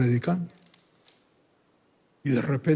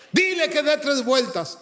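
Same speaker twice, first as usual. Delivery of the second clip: impassioned convert; 7 semitones higher than usual. Retold or imitated. Convert's challenge to God, acted.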